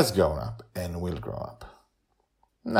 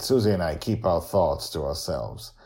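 plain reading voice

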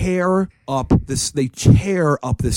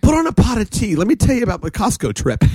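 lightly voiced gossipy housewife, pitched higher; pent-up housewife; 6 semitones higher than usual. Teasing himself as a rambling storyteller, lightly mocked housewife voice. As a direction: play a chatty housewife, a touch hammed-up. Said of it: "like a pent-up housewife with stories".

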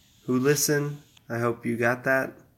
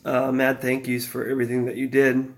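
ordinary talk, nothing marked

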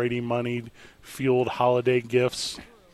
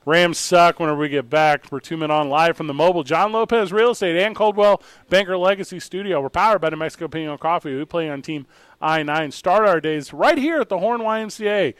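radio station ID; 3 semitones higher, 4 dB louder than usual. Acted. Station ID read.

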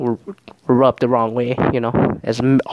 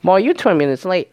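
mock sassy attitude, pitched up; put-on sass with a raised pitch; 6 semitones higher than usual. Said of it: "she has a shouting attitude".

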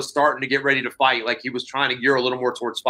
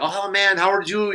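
higher-pitched, lightly voiced friendly greeting; jovial Brazilian; 6 semitones higher than usual. Brazilian grappler greets the visiting wrestler, lightly imitated joviality. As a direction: imitate a cheerful Brazilian welcoming a newcomer. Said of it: "jovial Brazilian".

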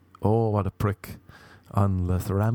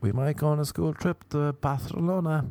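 higher-pitched teacher, "Barcelona" as Bah-the-lona; affected schoolteacher; 7 semitones higher than usual. Retold or imitated. Teacher announcing a school trip, affected higher voice. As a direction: raise the pitch, lisp the C in "Barcelona". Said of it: "a teacher in school in Ireland and she'd be like".

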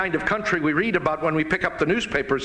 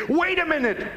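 higher, indignant mock protest; mock outcry; 9 semitones higher than usual. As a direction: parody the media crying foul, high and scornful. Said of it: "the immediate hue and cry from the media".

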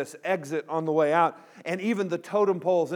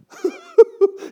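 high, loud blubbering wail; mock sobbing; 11 dB louder than usual. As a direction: imitate a child bawling into someone's leg. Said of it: "He's blowing snot bubbles".

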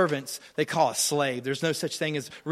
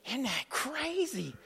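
much softer, slow, marvelling; hushed awe; 7 semitones higher, 10 dB quieter, slower than usual. Real, not acted. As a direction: let the wonder drop to a hush.

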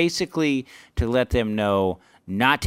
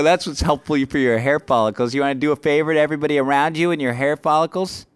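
fast, pushed-up sarcastic tirade; retold berating; 5 semitones higher, 4 dB louder, faster than usual. Comic berating a scruffy heckler from stage, retold telling-off. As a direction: rattle off a put-down, re-enacted.

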